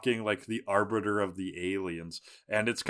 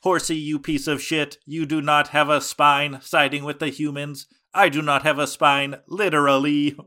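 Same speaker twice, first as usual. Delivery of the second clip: mock villain rant; 4 semitones higher, 7 dB louder than usual. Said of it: "Tentacruel is like".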